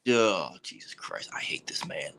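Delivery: low, soft, whispery exasperation; exasperated muttering. Real, not acted. Host fed up with teasing co-host, exasperated mutter. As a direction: mutter it under your breath, fed up.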